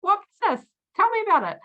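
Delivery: high-pitched, excited retold reaction; eager surprise. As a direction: pounce on the news, pitch leaping up. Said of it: "And I'm like".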